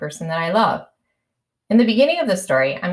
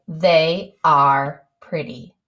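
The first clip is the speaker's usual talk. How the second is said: slow, lower, word by word; slow dictation; 4 semitones lower, much slower than usual. Shown as a demonstration. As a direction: read it out slowly as you write. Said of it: "I want to say".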